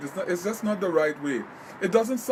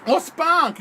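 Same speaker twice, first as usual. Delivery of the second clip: high, loud burst of getting it; sudden realization; 9 semitones higher, 5 dB louder than usual. Real, not acted. Live host finally understands a viewer's question, suddenly realizing. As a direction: blurt it as the penny drops.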